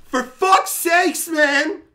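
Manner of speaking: high, loud exasperated outburst; loud mock tough-guy rant at a crying boy; exasperated yell. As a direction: yell it in disbelief at crying kids. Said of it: "And I'm like".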